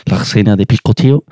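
lightly mimicked French-accented Spanish; mock a French-dubbed line lightly; imitated French accent. Podcaster mimics an annoying dubbed phrase, imitated French accent. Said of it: "el acento francés me gusta, pero hay cosas".

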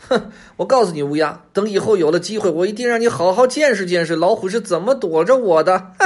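high, loud fox talking back defiantly; cocky storybook fox voice; stubborn fox. A narrator's reading. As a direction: boast back at the crow, pitched high. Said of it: "狐狸嘴硬地说".

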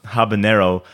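ordinary talk

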